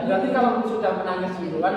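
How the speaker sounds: ordinary talk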